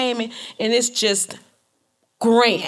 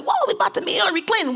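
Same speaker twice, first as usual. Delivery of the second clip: high, fast group gushing; excited; 12 semitones higher, faster than usual. Retold or imitated. Waiting backstage with others to meet Clinton, giddy excitement. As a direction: imitate everyone bubbling over at once. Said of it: "We all excited".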